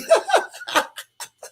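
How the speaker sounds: high wicked cackle, laughing through; evil laugh. Acted. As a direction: another villain cackle. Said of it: "let me do an evil laugh for you".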